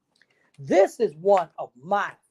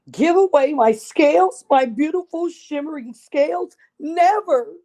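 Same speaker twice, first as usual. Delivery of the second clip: much higher, louder, appalled disbelief; shocked refusal; 8 semitones higher, 6 dB louder than usual. Acted. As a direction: recoil at the very idea. Vain fish refuses to share.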